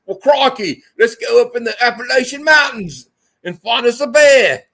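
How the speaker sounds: loud, high, hammed-up Australian; big excited Aussie caricature; Australian accent. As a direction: holler it like a cartoon Australian. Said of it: "Australian accent".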